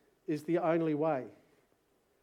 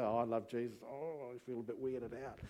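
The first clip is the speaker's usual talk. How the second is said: sheepish mumble; 5 dB quieter than usual. Retold or imitated. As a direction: say it like an awkward Aussie bloke. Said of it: "blokes, you know, you go".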